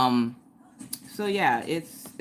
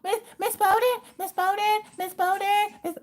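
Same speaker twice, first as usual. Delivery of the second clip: put-on high, whiny little kid calling teacher; mock six-year-old calling Miss Bowden. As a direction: nag the teacher like a first grader. Mimicking first graders calling teacher.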